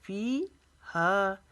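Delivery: फ़ीहा chanted slowly, both vowels held one alif; slow recited फ़ीहा; tajweed recitation. Shown as a demonstration. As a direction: stretch फ़ीहा evenly. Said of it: "इसको भी अलिफ जितना लंबा खींचना है".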